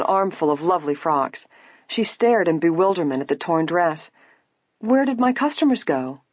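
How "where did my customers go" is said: higher-pitched, mildly worried question. A narrator's reading. Saleswoman finds her customers gone, worried. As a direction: ask with a touch of alarm. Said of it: "she asked Nancy worriedly".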